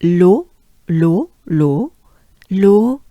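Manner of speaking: "l'eau" said as a pure round "lo", repeated; "l'eau" repeated with a rising end; French l'eau. Shown as a demonstration. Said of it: "as in".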